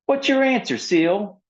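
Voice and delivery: very high, loud demanding voice; pressing demand. Acted. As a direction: insist on an answer right now. Lorelei pressing Seal.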